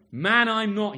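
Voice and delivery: a cornered man snapping back; sharp denial. Acted. Peter snapping back at his accuser, loud sharp denial.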